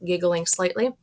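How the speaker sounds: ordinary delivery, nothing marked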